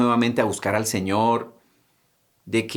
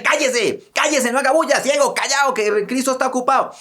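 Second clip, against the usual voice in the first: hushing crowd; 10 semitones higher than usual. Retold or imitated. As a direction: shut the beggar up, annoyed. Crowd hushing the blind man.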